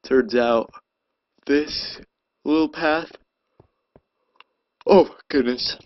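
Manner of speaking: talking right through a big yawn. Real, not acted. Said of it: "I'm yawning so much".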